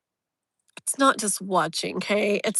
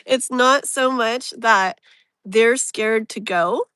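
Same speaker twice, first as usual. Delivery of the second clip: ordinary talk, nothing marked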